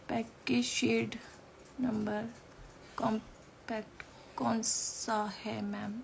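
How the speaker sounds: slow, soft read-out of a question; half-voiced, halting reading; reading to self. Real, not acted. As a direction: read the comment slowly under your breath. Reading a viewer's question to herself.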